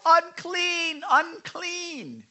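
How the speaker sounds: high, long mournful call; leper's cry. Retold or imitated. Pastor acting the leper crying out unclean, high wailing call. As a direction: wail it out like an outcast leper. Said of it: "he has to yell".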